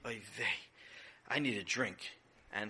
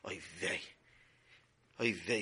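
weary sigh; 10 semitones lower, 6 dB quieter than usual. Acted. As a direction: groan it out, defeated. Mother despairs at the mess.

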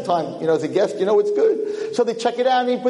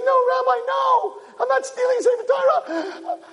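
panicked protest. Acted. Peasant denying theft to the rabbi, panicked. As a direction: beg him to believe you.

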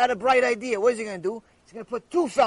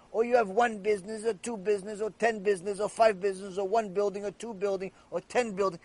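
ordinary delivery

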